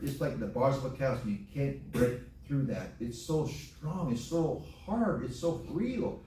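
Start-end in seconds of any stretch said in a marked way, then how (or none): 3.0-6.2 slow emphatic. Acted. Preacher drawing out emphasis.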